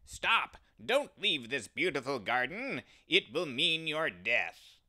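loud, high tempter's voice; evil one's call. Acted. The evil one calls the fleeing ram back with a loud shout. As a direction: shout him back toward the garden. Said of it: "The evil one appeared and said".

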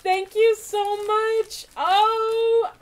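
slow, loud, high gushing thanks; excited gratitude. Real, not acted. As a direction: gush at full volume over a gift. Thrilled thanks for a gift.

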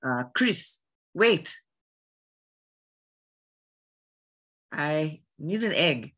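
slow African-accented delivery; measured African-accented Sheva. Shown as a demonstration. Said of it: "full African Sheva, might sound like this".

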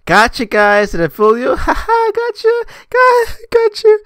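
mock prankster taunt. Mocking the prank YouTuber, a high loud jeering voice. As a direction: crow at the viewers like a smug prankster.

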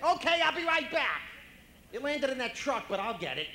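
high, loud, excited dog voice; eager dog. Acted. Dog sent for the stick, frantically eager. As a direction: gush with over-the-top eagerness to please. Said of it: "'Cause a dog will do anything".

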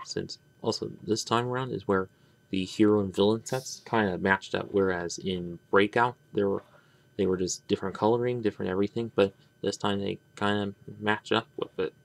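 ordinary talk, nothing marked

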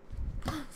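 short voiceless gasp of fright; startled gasp. Real, not acted. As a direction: suck in air, startled. Startled gasp in horror game.

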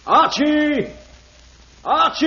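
loud high call down the crevice. Acted. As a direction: shout his name into the ice. Guide calling into the crevice.

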